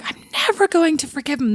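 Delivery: high-pitched, hammed-up vow of grudge; mock-furious vow, pitched high; mock rage. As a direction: play at being dramatically unforgiving. Imagined crying rage before sleep.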